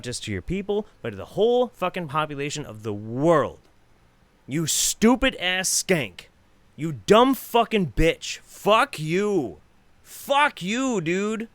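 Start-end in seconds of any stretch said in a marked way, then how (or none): 4.5-11.5 slow, punched angry insults, pitched high; real anger, rising higher on the curses; really mad. Real, not acted. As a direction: spit out the insults in drawn-out anger. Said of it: "I got really mad at this".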